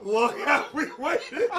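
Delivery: ordinary talk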